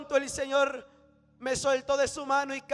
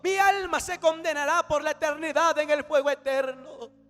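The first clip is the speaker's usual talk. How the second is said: raised, forceful martyr's refusal; defiant outcry; 5 semitones higher, 5 dB louder than usual. Retold or imitated. Tortured believer refusing to renounce his God, defiant outcry. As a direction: cry out with conviction, loud and high.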